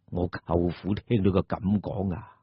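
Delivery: usual speaking voice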